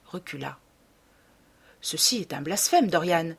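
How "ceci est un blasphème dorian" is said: plain reading voice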